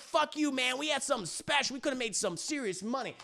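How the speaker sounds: acted high-pitched grievance; mock betrayed outburst. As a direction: complain like a jilted partner.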